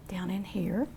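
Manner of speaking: soft whisper to herself. Real, not acted. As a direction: breathe it out while painting. Painter murmurs while working.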